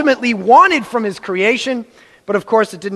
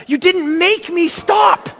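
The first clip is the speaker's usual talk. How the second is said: put-on high, slow whining kid; slow, raised childish protest; childish whine; 8 semitones higher, slower than usual. Shown as a demonstration. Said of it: "This is how I argued when I was a child".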